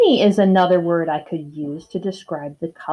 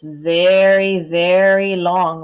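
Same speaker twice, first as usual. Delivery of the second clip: drawn out long; 5 dB louder, much slower than usual. Shown as a demonstration. Teacher stretching word for long.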